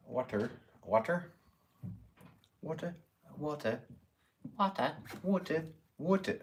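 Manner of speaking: mock English accent on water; playful imitation of a British water. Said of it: "I can't do an English accent".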